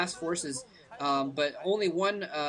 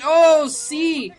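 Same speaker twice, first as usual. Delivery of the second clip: loud, high burst of surprise; real commentator's gasping "oh"; startled exclamation; 8 semitones higher, 12 dB louder than usual. Real, not acted. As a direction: cry out at a sudden play. Commentator reacts to sudden play.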